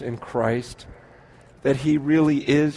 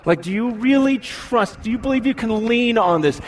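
normal talking voice

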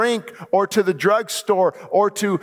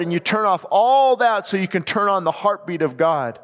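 ordinary talk

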